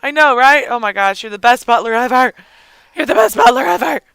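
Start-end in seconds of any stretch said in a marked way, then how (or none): 2.9-4.0 quick, silly character-voice echo; lightly hammed-up quick echo; put-on voice. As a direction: repeat it in a playful put-on voice. Repeats praise in put-on voice.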